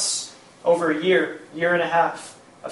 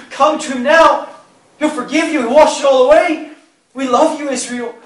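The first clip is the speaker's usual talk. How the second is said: much higher and louder, crying out to him; desperate plea; 10 semitones higher, 11 dB louder than usual. Retold or imitated. Wife begging husband to come to God, loud high plea. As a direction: plead with everything you have.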